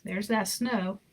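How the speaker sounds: ordinary delivery, nothing marked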